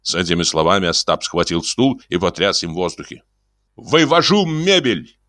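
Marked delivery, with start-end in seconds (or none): 3.8-5.2 decisive. Acted. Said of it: "решительно заявил Бендер".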